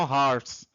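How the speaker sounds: "horse" mispronounced as "harts", with an A; faulty "horse" demo, A instead of O; wrong pronunciation. Shown as a demonstration. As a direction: say "horse" wrongly with an open A vowel. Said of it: "e não horse".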